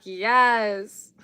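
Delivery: usual speaking voice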